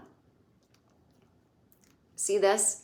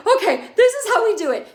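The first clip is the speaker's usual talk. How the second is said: high, loud, frantic-mom impression; hammed-up flustered mother taking charge; Charlotte; 13 semitones higher, 8 dB louder than usual. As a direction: instruct in a high, mock-urgent voice. Imitating Charlotte instructing panicking Lily.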